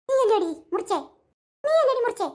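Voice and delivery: rising accusing ghost voice; menacing ghost accusing the girl. Acted. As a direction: point the finger and scare her. Ghost story scare climax.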